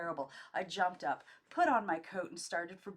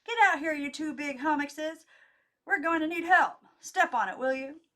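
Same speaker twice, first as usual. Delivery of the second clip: loud, high bossy call; shouting; 9 semitones higher, 14 dB louder than usual. A narrator's reading. Nora calling the men out to help, loud and bossy. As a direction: yell for the boys to hurry.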